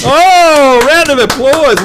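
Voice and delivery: loud, high burst of applause-calling; excited cheer. Real, not acted. Host rallies applause for learner, excited cheer. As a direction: whoop it out loudly and high.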